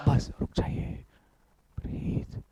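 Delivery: whispered pause for breath; very soft, voiceless hush; hushed calming. Real, not acted. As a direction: whisper it, settling the class. Calming class after the chant.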